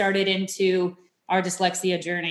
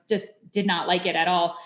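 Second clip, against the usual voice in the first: ordinary talk, nothing marked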